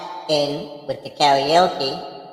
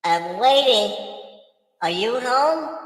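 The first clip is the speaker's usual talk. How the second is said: calling down a hallway; 8 semitones higher, slower than usual. Pretend. Ghost calling through a house.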